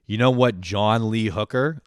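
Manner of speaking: lightly voiced excited dad. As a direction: lightly imitate dad proudly setting up his favorite fact. Retold dad's proud announcement.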